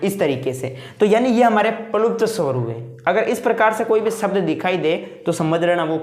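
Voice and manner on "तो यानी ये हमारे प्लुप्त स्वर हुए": ordinary talk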